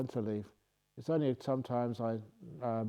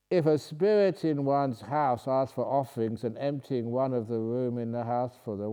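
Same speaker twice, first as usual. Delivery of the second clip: usual speaking voice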